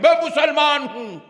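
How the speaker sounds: proud declaration. Retold or imitated. Preacher imitating Muslims proud of their faith, boastful. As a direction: mimic someone declaring it with pride. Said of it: "بڑے فخر سے کہہ رہے ہیں".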